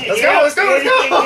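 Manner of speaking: urgent cry. Pretend. Player rushing the party into the vehicle, urgent shout. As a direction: play at hurrying everyone along, voice lifted.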